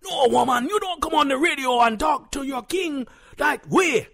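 high, indignant shout; outraged husband. Acted. Self-styled king rebukes his wife, outraged shout. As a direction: thunder at her in a high, strained voice.

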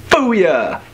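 triumphant booyah. Acted. Triumph after magic trick.

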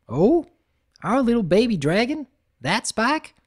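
can't believe it of Spike; surprised Applejack. Acted.

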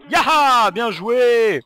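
loud, high whoop of triumph; excited cheer. Real, not acted. Gamer cheering his own kill, loud excited whoop.

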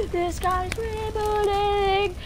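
high, slow drawn-out scream; hammed-up alarm scream; screaming van. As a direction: wail it like a siren across the map. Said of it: "they start screaming like".

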